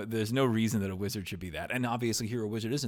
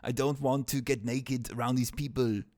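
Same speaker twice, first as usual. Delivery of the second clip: higher put-on Conan accent; 4 semitones higher, 4 dB louder than usual. Conan refusing to undress before people, in a Conan accent. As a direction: keep the Conan accent going. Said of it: "I can do this accent".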